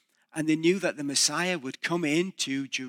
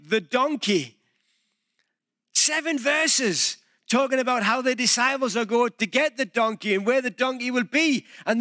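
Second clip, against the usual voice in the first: raised, insistent preacher voice; emphatic preaching; 8 semitones higher, 3 dB louder than usual. Acted. Pastor pressing the congregation on the donkey detail, emphatic preaching. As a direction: drive the point home from the pulpit.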